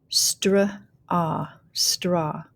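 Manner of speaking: "straw" built from str and ah; teacher blending "straw" slowly; phonics blending. Shown as a demonstration. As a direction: sound out str, ah, then join as "straw". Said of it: "go ahead and start blending".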